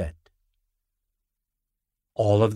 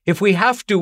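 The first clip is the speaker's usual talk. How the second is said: higher, louder call; 9 semitones higher, 5 dB louder, faster than usual. A narrator's reading. Family calling to Captain Mike as he leaves, loud call. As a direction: shout it after the boat. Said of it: "we called".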